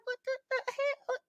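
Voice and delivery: high, soft, faltering question; aghast disbelief. Retold or imitated. Customer reacting to the young man's cussing, high hushed shock. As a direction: squeak it out softly, dumbfounded.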